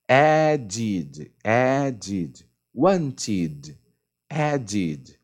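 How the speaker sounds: "added" and "wanted" each ending in a clear "-id"; teacher drilling the "-ed" ending aloud; pronunciation drill. Shown as a demonstration. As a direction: model "added" as "ed-id", word by word. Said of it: "a pronúncia ... tem que ser de I D".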